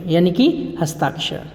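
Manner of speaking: ordinary delivery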